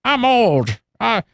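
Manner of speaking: put-on high, whiny grumpy old-timer. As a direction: play it like a creaky old gamer.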